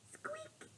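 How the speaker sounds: very soft high squeak; a faint little squeak; hushed mouse squeak; tiny squeak. Acted.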